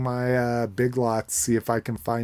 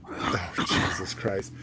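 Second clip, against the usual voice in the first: soft unvoiced exasperation; whispered exclamation; 3 semitones lower, 4 dB quieter than usual. Real, not acted. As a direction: breathe it out in a whisper. Second reaction, under breath.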